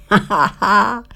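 high teasing ha ha ha; mocking laugh. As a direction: laugh at them, carefree and smug. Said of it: "laughing at the ants".

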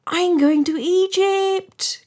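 high, loud, drawn-out announcement; swallow's sing-out boast; excited cry. A narrator's reading. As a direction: call it out high and slow. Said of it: "cried the swallow".

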